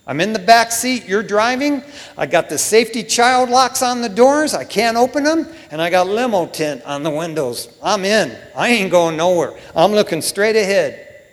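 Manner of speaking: loud, emphatic pledge as Peter; brash, acted-out declaration of loyalty; insistent vow. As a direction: pile up the assurances, loudly and comically overconfident.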